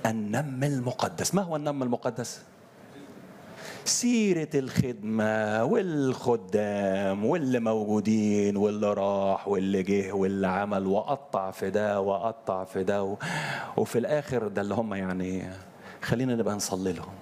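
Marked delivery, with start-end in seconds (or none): 3.8-13.2 gossiping. Retold or imitated. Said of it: "ما أسميه أنا النم المقدس".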